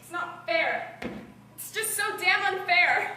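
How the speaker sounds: anguished outburst. Acted. Hazel cries out to Augustus in grief, loud and anguished. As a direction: Hazel lashes out at the unfairness, voice climbing.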